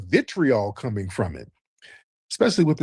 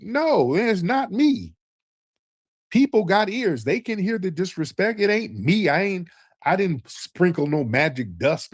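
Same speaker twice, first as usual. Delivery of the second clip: heated denial; 7 semitones higher than usual. Real, not acted. Angry denial of blame.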